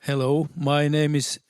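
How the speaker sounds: ordinary delivery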